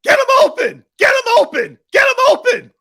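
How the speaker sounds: high-pitched frantic shout, repeated; loud, piercing mock coach's holler; Eric Spoelstra yelling. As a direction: yell urgently across the court in imitation. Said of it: "yelling to his teammates".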